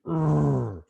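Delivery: short, loud growled "urgh"; elk growl. Shown as a demonstration. Hunter showing how close-in bull elk sound, loud growl. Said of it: "and the growls and the lip balls".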